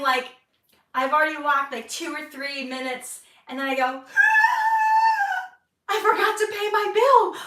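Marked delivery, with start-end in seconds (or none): 4.1-5.5 sharp high gasp of sudden alarm; loud shocked gasp; panicked gasp. Retold or imitated. Realizes she forgot bill. 5.9-7.4 high, loud panicked outcry; loud alarmed shout, near crying. Retold or imitated. Sudden panic remembering bill.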